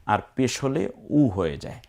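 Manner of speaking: ordinary talk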